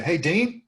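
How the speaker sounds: "Dean" lifted upward like a question; rising intonation on the name. Shown as a demonstration. Cold caller greeting an agent by name, rising intonation. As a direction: go up on "Dean", as if you know him. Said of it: "you always go up on the name".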